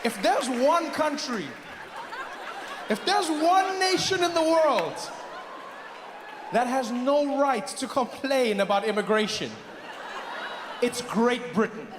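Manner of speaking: slow, high-pitched proclamation; emphatic declamation. Acted. Comedian indicting Britain over immigration, slow impassioned declaiming. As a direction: thunder it out slowly, pausing between phrases.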